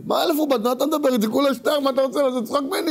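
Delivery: high-pitched acted outrage; indignant haggling. Haggler outraged at a thousand-ruble price, high acted indignation. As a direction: object in disbelief, pitch way up.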